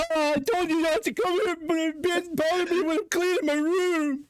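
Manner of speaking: very high falsetto voice; Scary Movie quote. Retold or imitated. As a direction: squeak it like a movie character. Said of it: "that reference".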